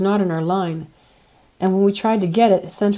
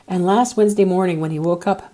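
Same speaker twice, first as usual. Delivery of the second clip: plain reading voice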